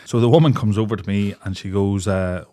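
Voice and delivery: normal talking voice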